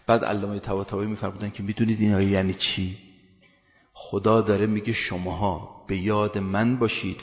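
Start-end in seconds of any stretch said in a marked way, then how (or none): none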